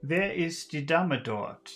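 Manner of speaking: normal talking voice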